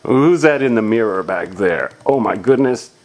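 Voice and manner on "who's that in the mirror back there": usual speaking voice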